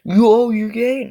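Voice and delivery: high, loud, drawn-out taunt; mock taunting bully. Bully taunts him in a sketch, mock teasing. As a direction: needle them in a high, stretched voice.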